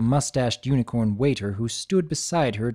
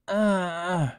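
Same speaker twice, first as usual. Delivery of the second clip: pained moan; 5 semitones higher than usual. Acted. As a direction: moan from a hard fall. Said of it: "At the sound of the moan".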